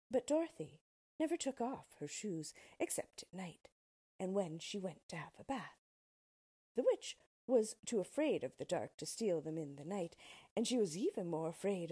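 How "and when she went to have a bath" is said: plain reading voice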